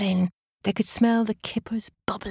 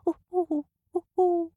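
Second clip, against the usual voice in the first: high hooting owl; 11 semitones higher than usual. Acted. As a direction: hoot through the keyhole. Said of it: "hooted through the keyhole".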